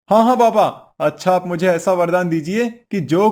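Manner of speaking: sudden high, loud eager yes; a husband overeager for his boon; eager burst. Acted. Eager reply to the boon-giver.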